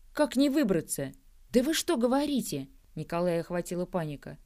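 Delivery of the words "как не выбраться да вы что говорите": high, panicked questions; alarmed and pleading. A narrator's reading. Panic at stuck companion.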